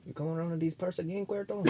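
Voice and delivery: hushed, hurried Spanish accent. Shown as a demonstration. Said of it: "hold on, do it again".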